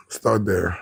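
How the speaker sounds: usual speaking voice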